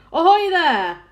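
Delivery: high, very loud hail; commanding shout. A narrator's reading. Police chief hailing the unseen travellers up top, loud shout. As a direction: holler it up to someone far above. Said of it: "shouted the chief of police".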